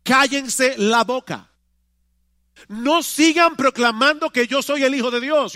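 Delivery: Jesus ordering the demons to be silent, loud and slow; stern command. Retold or imitated. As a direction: rebuke the demons with authority. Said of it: "Esa es mi orden".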